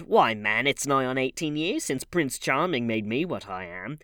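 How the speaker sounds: loud, scornful retort; bitter sneer. A narrator's reading. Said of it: "she sneered".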